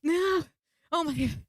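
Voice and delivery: high, loud panicked cries. Retold or imitated. Teleporting too fast, a high panicked no. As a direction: cry out in rising panic. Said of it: "I'm like".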